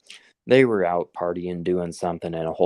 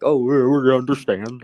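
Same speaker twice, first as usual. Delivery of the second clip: mocking worker voice; 5 semitones higher, 5 dB louder than usual. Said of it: "they're like".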